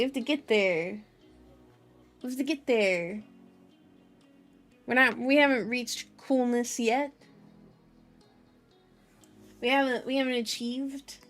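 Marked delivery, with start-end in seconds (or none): none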